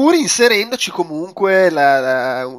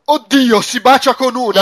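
hammed-up alarm, higher and louder; mock panic; 6 semitones higher, 4 dB louder, slower than usual. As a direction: play a viewer panicking, higher. Mocking a panicking viewer.

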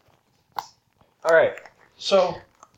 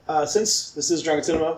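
ordinary talk, nothing marked

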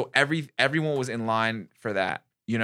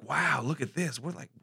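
put-on childlike wonder; 4 semitones higher than usual. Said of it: "But it was like".

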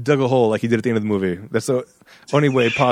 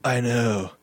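low, soft mock movie-hero line; understated imitation of an action star consoling someone; quiet, deadpan bad-movie reassurance; Keanu Reeves; 5 semitones lower, 4 dB quieter than usual. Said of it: "Keanu Reeves would have said".

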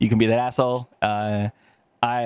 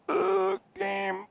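muttering while searching; 6 dB quieter than usual. Real, not acted. Reading file names aloud.

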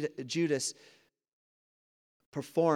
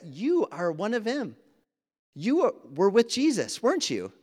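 higher, pointed accusation, louder on the middle line; accusing bystanders; 6 semitones higher, 3 dB louder than usual. Retold or imitated. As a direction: corner him with the question. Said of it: "and the people said".

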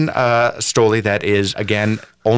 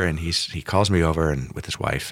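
ordinary talk, nothing marked

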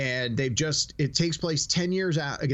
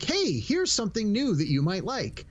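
higher-pitched mock recommendation voice; chirpy Netflix promo; 5 semitones higher than usual. Netflix pitching a new show to a viewer, mock-helpful. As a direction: pipe up like a pushy streaming app. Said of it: "it's really good for saying".

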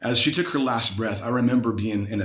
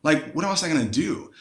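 desperate retold plea; 6 semitones higher, faster than usual. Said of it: "I was like".

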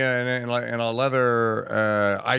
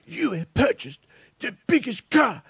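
high, shouted mock Greta Thunberg; Greta rant; 11 semitones higher than usual. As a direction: screech it like an outraged teen activist. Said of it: "Greta impression, mocking".

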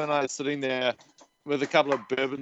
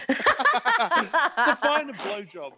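laughing through words; 9 semitones higher, much slower than usual. Real, not acted. Laughing at crude joke.

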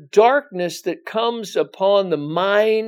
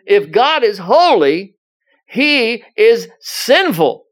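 higher and louder, slow and emphatic preaching; building preaching; 6 semitones higher, 5 dB louder, slower than usual. Acted. Preacher pressing his listeners on sin, higher, louder and slow. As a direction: drive each line home, higher and louder.

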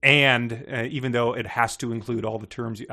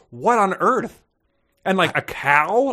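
incredulous; 5 semitones higher, 3 dB louder, slower than usual. Acted. Reading character's baffled thoughts aloud.

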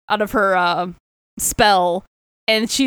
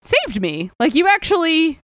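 higher-pitched, amazed retold plea; touched Soren; 7 semitones higher than usual. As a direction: act out being stunned by a rescue. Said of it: "And Soren's like".

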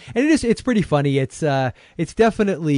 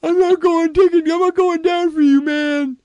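tough guy voice; 6 dB louder than usual. Acted. Mock tough-guy loyalty bit.

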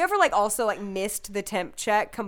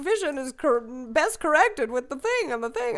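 pedantic correction; 5 semitones higher than usual. Pretend. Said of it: "I wanna be like".

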